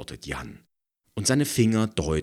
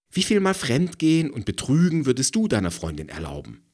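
provocative; 9 semitones higher, 6 dB louder, faster than usual. A narrator's reading. Provocative challenge.

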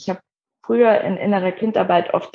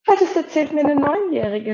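higher-pitched retold amazement; amazed thought; 8 semitones higher than usual. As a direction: marvel to yourself in a higher voice. Retells her amazed thought. (staunend, mit hoher Stimme)

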